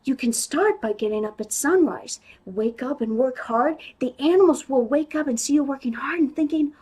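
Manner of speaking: ordinary talk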